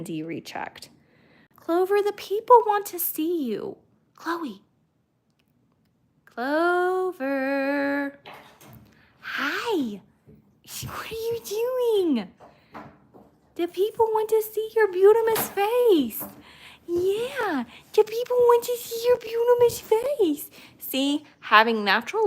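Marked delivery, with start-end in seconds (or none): 1.5-4.6 high sing-song pet voice. Real, not acted. High baby talk to dog. 6.3-20.5 high sing-song pet voice. Real, not acted. Cooing to dog on camera.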